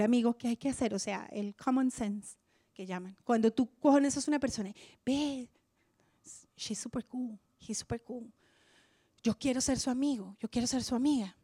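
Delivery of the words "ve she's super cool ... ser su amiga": softly voiced eager friend-seeker, hushed; whispered admiring quote. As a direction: murmur it like a confiding aside. Quoting someone wanting a friend.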